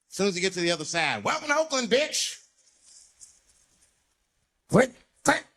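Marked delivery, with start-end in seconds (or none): 1.2-2.4 high mocking voice; mock Oakland greeting; hostile welcome. Greeting sign mocked at the border. 4.7-5.4 loud clicking gun noise; imitated pistol click; gun cock sound.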